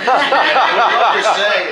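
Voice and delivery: slow, loud laughing aside. Real, not acted. Storyteller laughs at himself about the money. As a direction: laugh your way through the words.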